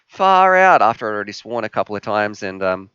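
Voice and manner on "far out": much higher, louder cover-up cry; covering exclamation. Retold or imitated. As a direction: loudly swap the swear for something harmless. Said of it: "And then I just changed it to".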